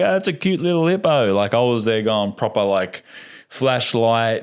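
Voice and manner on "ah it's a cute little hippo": mock cute. Mock naive tourist reaction.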